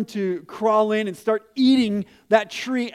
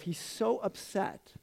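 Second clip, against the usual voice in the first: soft, slow aside; 10 dB quieter, slower than usual. Real, not acted. Comment after Jonah's plea.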